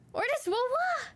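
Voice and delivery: high, sing-song wah wah at the cat; wah wah call. Real, not acted. Teasing her screaming cat, she calls high wah wah. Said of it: "He likes it when I go wah, wah at him".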